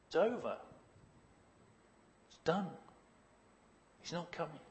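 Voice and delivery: soft, acted giving up; quietly breaking bad news; hushed resignation. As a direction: let each phrase drop away softly. Acting the village giving up hope.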